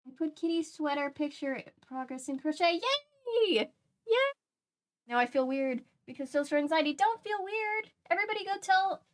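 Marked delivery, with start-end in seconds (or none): 2.7-3.7 high, bright double yay; excited cheer. Real, not acted. Cheering a viewer's crochet picture, excited.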